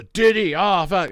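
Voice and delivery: loud, high mock panic; mock outrage. Mimicking people now upset at [name], high mock outrage. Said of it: "Now people are".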